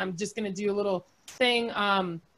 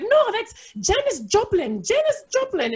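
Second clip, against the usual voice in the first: put-on high, loud mom with Puerto Rican accent; hammed-up Puerto Rican accent, pitched way up; bad Puerto Rican accent; 11 semitones higher, 4 dB louder than usual. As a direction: imitate an exasperated Puerto Rican mother. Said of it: "that's a horrible Puerto Rican accent".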